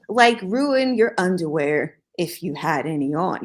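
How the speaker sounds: usual speaking voice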